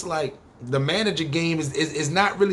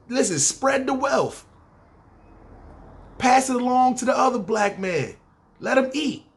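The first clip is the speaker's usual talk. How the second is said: preachy plea; 6 semitones higher than usual. Acted.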